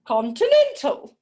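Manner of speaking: hammed-up cartoon voice; bigger silly voice. Shown as a demonstration. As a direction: go bigger the second time. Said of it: "I always do it three times".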